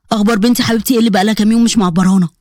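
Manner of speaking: lightly voiced dad fondly checking in; affectionate father. Father to his withdrawn daughter, affectionate.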